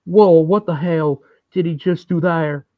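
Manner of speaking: a hammed-up Bill Clinton voice. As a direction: turn the quip into a folksy campaign aside. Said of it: "That's my impression of Bill Clinton".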